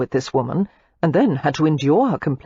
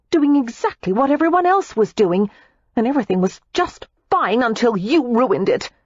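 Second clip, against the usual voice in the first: put-on higher, heated young woman's voice; Daisy, furious; 6 semitones higher than usual. A narrator's reading. Daisy snaps back at Matthew in fury. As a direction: hurl the accusation back at him.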